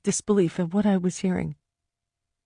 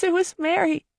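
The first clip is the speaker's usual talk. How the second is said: much higher, crying out; desperate cry; 11 semitones higher than usual. Acted. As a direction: cry it out, frantic to be believed. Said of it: "I cried".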